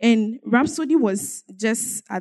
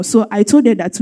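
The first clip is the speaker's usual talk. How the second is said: ordinary delivery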